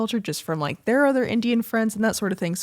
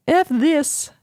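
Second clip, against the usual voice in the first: voicing the dog; 5 semitones higher, 6 dB louder, much slower than usual. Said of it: "She's like".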